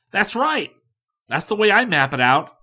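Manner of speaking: high, loud and hurried; no time to fool around; impatient go-getter. A narrator's reading. Likens insisting on tonight, high and hasty.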